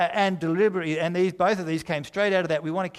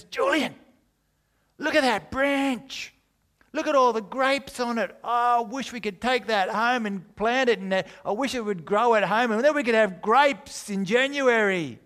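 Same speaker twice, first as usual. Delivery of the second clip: higher, louder gushing wife voice; excited wife; 8 semitones higher, 4 dB louder than usual. Acted. Sarah to husband Julian, gushing high excited voice. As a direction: gush like a thrilled wife. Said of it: "Sarah says".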